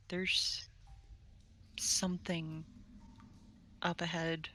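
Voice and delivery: hushed, halting whisper. Acted. Character warns a party member of something up ahead, whispering. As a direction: whisper it softly, piece by piece.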